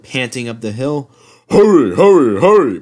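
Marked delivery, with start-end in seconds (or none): 1.5-2.8 loud, higher-pitched chugging calls; puffing engine. A narrator's reading. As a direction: puff each word out in a pumping rhythm. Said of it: "puffed Gordon".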